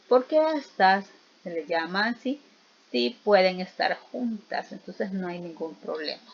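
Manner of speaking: ordinary talk, nothing marked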